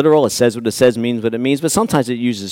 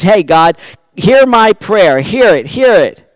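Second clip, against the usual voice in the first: pleading cry; 6 semitones higher, 6 dB louder, slower than usual. Acted. Preacher voicing David's plea.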